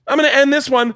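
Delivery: high, whiny fake sob; mock crying. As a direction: imitate her crying over the bike. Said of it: "Just starts crying".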